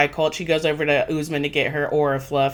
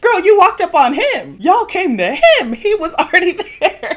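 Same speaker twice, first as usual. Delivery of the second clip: high, loud, incredulous protest; exasperated scolding; 13 semitones higher, 5 dB louder than usual. Acted. Host scolds the woman who approached him, exasperated. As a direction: yell it at her in hammed-up outrage.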